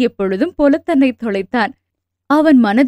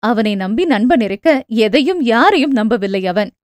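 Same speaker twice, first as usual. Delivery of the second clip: ordinary delivery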